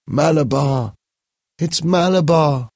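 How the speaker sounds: put-on a feverish boy calls out; calling out in sleep. A narrator's reading. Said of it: "Paul called out in his sleep".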